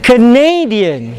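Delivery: slow "Canadian" with long NAY; "Canadian" said as "ca-NAY-dian"; stress in the middle. Shown as a demonstration. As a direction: lean on the second syllable of "Canadian". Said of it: "ne is in the middle".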